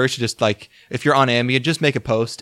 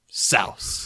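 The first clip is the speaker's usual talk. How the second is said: deadpan whispered punchline; 5 semitones lower, 6 dB quieter than usual. Retold or imitated. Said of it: "and then".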